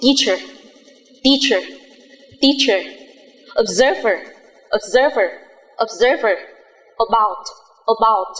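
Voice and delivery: "teacher", "observer", "about" each repeated with a weak schwa; teacher drilling schwa words; model pronunciation. Shown as a demonstration. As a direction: reduce the unstressed vowel to a quick uh. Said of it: "Ví dụ".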